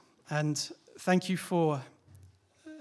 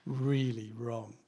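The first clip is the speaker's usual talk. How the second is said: ordinary talk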